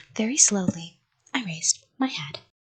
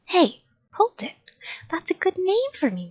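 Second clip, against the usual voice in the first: high eager "hey, hold it"; excited idea; 10 semitones higher than usual. Acted. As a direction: burst out with the new idea.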